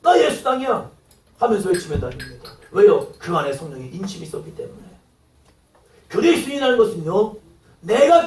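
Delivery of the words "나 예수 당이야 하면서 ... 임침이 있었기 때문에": usual speaking voice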